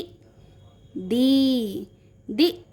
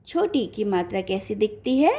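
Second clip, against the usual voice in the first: ordinary delivery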